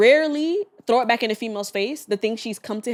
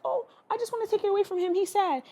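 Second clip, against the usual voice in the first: lightly voiced wife, higher and soft; a hint of a fretful wife, higher; soft, higher mock of the fretful wife; imitating the wife; 9 semitones higher, 5 dB quieter than usual. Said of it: "she was like to the wife".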